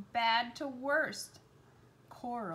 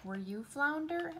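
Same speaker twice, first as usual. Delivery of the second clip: teasing; 5 semitones higher, 5 dB louder than usual. Acted. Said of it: "Ariel teased".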